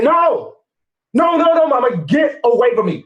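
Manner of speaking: put-on a scared boy backing away; panicked refusal.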